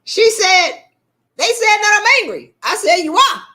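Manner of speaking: high, loud gleeful retelling; loud, high-pitched back-and-forth; animated retelling. Retold or imitated. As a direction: retell the exchange at full volume. Gleeful retelling after laughing fit.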